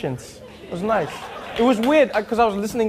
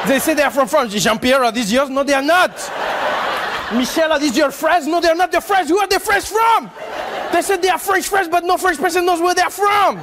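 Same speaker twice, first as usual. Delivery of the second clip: mock French outrage climbing higher and higher; indignant French accent; 7 semitones higher than usual. French people disowning the fries, French accent building to mock outrage. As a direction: build to a high, exasperated French-accented cry. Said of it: "You guys also like".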